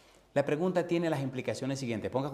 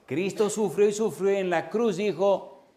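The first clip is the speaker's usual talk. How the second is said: louder, emphatic sermon line; fervent preaching; 6 semitones higher, 6 dB louder, slower than usual. Acted. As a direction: build up the suffering for the crowd. Preaching at full force. (predicación fervorosa)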